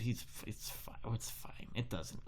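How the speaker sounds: unvoiced whisper to himself; the baker caving, whispering; whispered aside. Muttering to himself, giving in.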